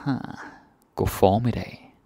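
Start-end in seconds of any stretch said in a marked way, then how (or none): none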